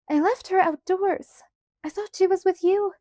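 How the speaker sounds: high, loud, anxious mother; frantic explaining. A narrator's reading. Ma tells Pa she left Grace outdoors, frantic. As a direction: protest in a rising panic. Ma frantic over lost child.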